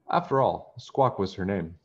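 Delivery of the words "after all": ordinary delivery, nothing marked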